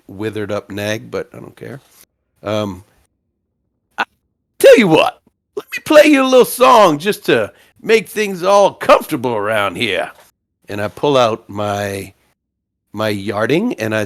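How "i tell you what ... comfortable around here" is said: high, loud, ingratiating bard voice; pitched-up folksy patter; folksy bard haggling. Acted. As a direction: offer a tune like a showy traveling musician.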